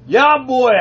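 hammed-up mock Jamaican shout-out; bad Jamaican accent. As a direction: throw in a fake Jamaican accent. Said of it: "do I sound Jamaican as fuck?".

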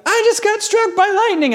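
very high, strained mock shout; high-pitched acted-out outcry; retold shocked yell. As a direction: imitate a man yelling just after a shock. Retold boss just hit by lightning.

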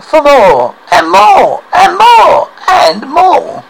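building excitement. Acted. Greedy eating, building repetition.

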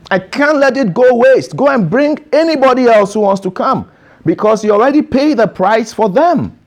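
mock-angry master of the house. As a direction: hold firm like a wronged host. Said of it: "That was the expression of the man".

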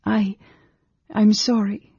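high, halting tearful apology; falter, pausing after the first word. Acted. Girl apologizing after sobbing, faltering. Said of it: "Anne Barrel faltered".

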